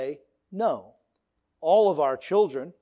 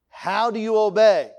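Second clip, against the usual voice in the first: louder call-and-response question; dad's quiz call; 4 semitones higher, 7 dB louder than usual. Retold or imitated. Father drilling kids on obedience, loud call. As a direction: bark it as the drill leader. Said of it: "dad quizzing kids".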